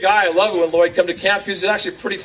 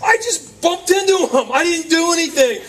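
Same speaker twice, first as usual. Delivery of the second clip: put-on protesting child; 8 semitones higher than usual. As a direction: plead innocence like a kid caught out. Acted child protesting innocence to mom.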